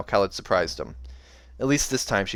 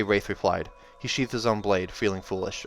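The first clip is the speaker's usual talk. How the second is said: plain reading voice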